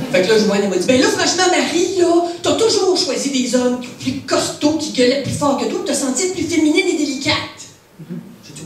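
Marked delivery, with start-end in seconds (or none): 0.9-7.4 lightly acted scolding, pitched up; blunt friend. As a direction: hand out home truths with a touch of exasperation. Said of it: "te dit tes quatre vérités en pleine face". (copine franche qui sermonne un peu)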